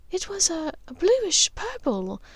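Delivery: searching for the word, distracted; mother recalling a colour, dreamily; Narcissa lost in thought. A narrator's reading. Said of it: "lost in thought".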